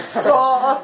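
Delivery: laughing imitation of a loud whoop; loud yell. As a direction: burst out high and loud, half laughing. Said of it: "大声で".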